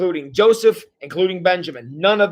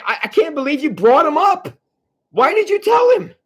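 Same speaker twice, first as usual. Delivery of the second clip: high-pitched, incredulous father scolding; exasperated father; 9 semitones higher than usual. Acted. Jacob blames sons for bringing Benjamin up, exasperated outburst. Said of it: "so he's saying".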